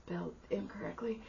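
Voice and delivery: hushed inner aside. Retold or imitated. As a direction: mutter it under your breath to yourself. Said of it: "in my head, I was like".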